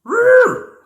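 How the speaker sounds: high, loud playful doggy growl. A narrator's reading. Hudson the dog agreeing, playful growl. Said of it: "Hudson agreed".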